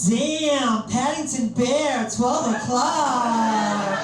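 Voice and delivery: slow, high, stretched-out American drawl; sassy mock woman on the prowl, words dragged out; lustful girl. Girl spotting him across a bar, hammed-up slow lust. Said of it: "is like".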